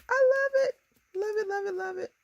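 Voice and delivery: very high sing-out squeal, easing in volume; giddy high-pitched gushing; squealing delight. Real, not acted. Gushing over the finished card.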